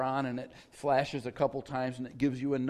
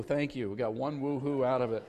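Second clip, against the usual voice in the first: ordinary talk, nothing marked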